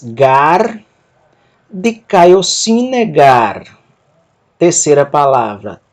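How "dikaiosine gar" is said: each syllable drawn out very slowly; slow Greek demo. Shown as a demonstration. Teacher models Greek for students, very slow. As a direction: spell the word out slowly for learners. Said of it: "Vou ler o texto".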